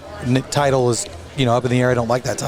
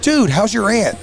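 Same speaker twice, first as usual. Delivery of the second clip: higher, bright mock greeting; fake-friendly greeting; 8 semitones higher, slower than usual. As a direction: pretend to know them well. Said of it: "I'm like".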